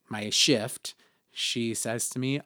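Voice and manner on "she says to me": ordinary talk, nothing marked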